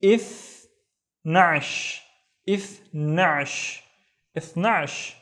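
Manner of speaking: اثناش drawn out slowly, the first take long; Arabic model word. Shown as a demonstration. Teacher models اثناش for learners, slow and clear. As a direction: say اثناش slowly, letting the final ش hiss. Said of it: "which is".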